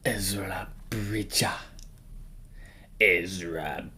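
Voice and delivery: drawn-out, hushed name with lingering sounds; slow whisper. Pretend. Ranker introducing Ezra Bridger, slow whisper.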